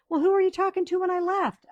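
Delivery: the husband demanding an answer, hammed up; pressing husband. Husband pressing his wife about the call, mock loud. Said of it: "He goes".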